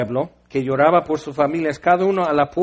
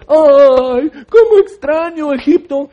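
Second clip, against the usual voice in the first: loud high hammed-up whine; whining Israelite; 8 semitones higher, 9 dB louder, slower than usual. Israelites moan to Moses about Egypt, loud mock whining. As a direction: wail it like a spoiled crowd.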